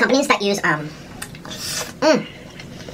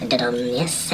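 whispered jingle; 12 semitones lower, 5 dB quieter, much slower than usual. Pretend.

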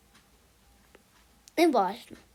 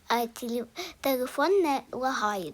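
ordinary talk, nothing marked

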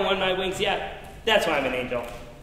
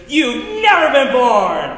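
excited announcement; 6 semitones higher, 11 dB louder, slower than usual. Acted. Angel announces the granted wish.

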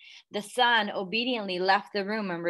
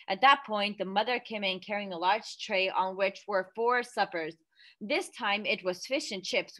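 ordinary delivery, nothing marked

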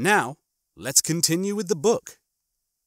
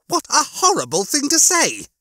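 high-pitched outraged exclamation; protest, thoroughly offended; scandalized, pitched-up retort; appalled indignation; 12 semitones higher, 3 dB louder than usual. A narrator's reading. Lister appalled at Rimmer's relish.

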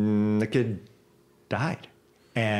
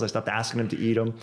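ordinary delivery

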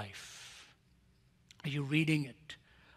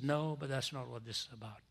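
hushed retelling; 4 semitones lower, 7 dB quieter than usual. Retold or imitated. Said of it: "He said".

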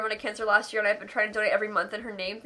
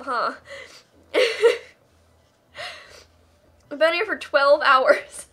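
ordinary delivery

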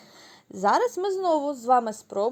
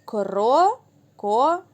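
Крокодил said very slowly, syllable by syllable, a little louder; clapped syllables; 4 dB louder, much slower than usual. Shown as a demonstration. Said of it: "Дивіться". (повільно, по складах)

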